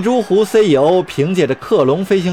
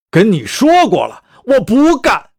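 loud, emphatic refusal; almost bellow your refusal, rising at the end; near roar; 4 semitones higher, 4 dB louder than usual. Acted. Otero refuses the agent's demand, almost roaring. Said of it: "奥特罗几乎是咆哮着说".

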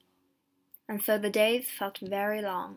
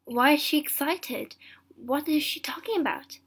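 higher, bewildered questioning; puzzled thought; 5 semitones higher, 3 dB louder than usual. A narrator's reading. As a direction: wonder what is going on. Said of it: "he thought".

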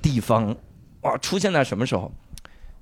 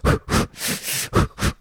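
slow unvoiced huffing breaths; breathing demo; much slower than usual. Shown as a demonstration. Showing the hiss-hiss huff-huff running breath, slow unvoiced puffs. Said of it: "就这么跑".

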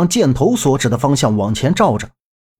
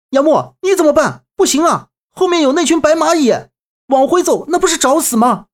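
panicked shouting; 11 semitones higher than usual. A narrator's reading. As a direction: yell in panic while backing away. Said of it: "一边退一边大声说".

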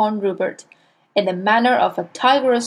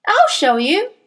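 put-on much higher bold child voice; piped up; 10 semitones higher, 4 dB louder than usual. A narrator's reading. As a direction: pipe up bravely to help. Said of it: "piped up the brave Nigel".

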